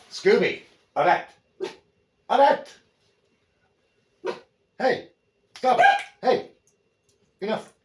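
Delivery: sharp, high, loud commands; real exasperated shouts at a pet; scolding the dog. Real, not acted. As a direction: call out to stop a misbehaving dog.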